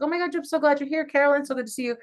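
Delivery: high, quick, excited retold hello; squealy, rushed mock-social greeting; gushing greeting. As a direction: gush at friends you're delighted to see.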